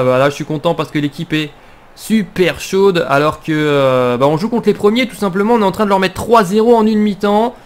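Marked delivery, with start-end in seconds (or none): none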